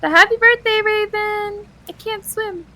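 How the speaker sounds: high, light vine voice; quoting a vine. Retold or imitated. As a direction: lightly mimic the kid in the clip. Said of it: "that vine".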